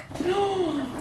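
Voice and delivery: soft gasp of discovery; quick, high intake of breath; surprised gasp. Real, not acted. Surprised by finding a strap.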